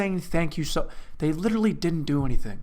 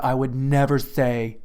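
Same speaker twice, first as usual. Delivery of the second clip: usual speaking voice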